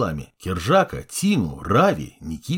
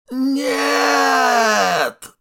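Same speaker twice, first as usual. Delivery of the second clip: high, loud cry full of pain; pained moan; 4 dB louder than usual. Acted. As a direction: wail it like a wounded ghost. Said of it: "наполненный болью стон".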